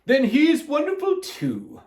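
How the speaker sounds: high, slow lady's voice; Miss Ralph. A narrator's reading. Miss Ralph praises Alkaloo, high put-on voice. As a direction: gush slowly in a high voice. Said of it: "said Miss Ralph".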